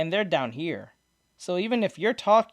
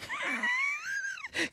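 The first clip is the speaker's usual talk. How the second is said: a sudden high burst of laughter; real laughter; 11 dB quieter than usual. Real, not acted. Laughing hard at his friend's reply, real laughter.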